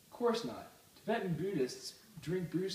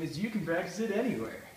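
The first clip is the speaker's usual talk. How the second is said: normal talking voice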